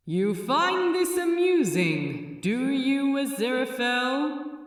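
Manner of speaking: loud, sharp rebuke from above; the Metatron snaps at his laugh. A narrator's reading. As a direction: cut him down sharply. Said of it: "said the Metatron sharply".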